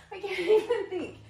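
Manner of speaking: laughing through the words; breathless giggle while talking. Real, not acted. Laughs mid-workout, losing track.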